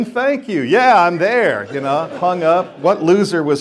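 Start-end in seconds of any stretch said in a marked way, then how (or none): 0.0-0.6 gushing thanks, much higher; "Thank you" gushed high and eager like a kid; excited kid. Retold or imitated. Star thanking the coach's office, eager and high. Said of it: "immediately I'm a kid again". 0.6-1.7 put-on eager kid. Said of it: "immediately I'm a kid again".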